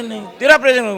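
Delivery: high, loud insistence; acted loud, high protest; loud insistent son. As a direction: overrule your father at full volume. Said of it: "ਉੱਚੀ ਤਰ੍ਹਾਂ ਬੋਲਦਾ".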